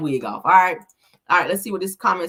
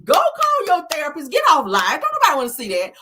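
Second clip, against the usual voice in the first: exasperated outburst; 3 dB louder than usual. Real, not acted. Scolding Destiny's livestream.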